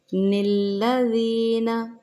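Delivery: निल्लज़ीना with a short kasra on the noon, slow; noon qutni. Shown as a demonstration. Teacher demonstrates noon qutni in निल्लज़ीना. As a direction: say निल्लज़ीना with the noon joined into the लाम. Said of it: "इस तरह से इसको अदा किया जाता है".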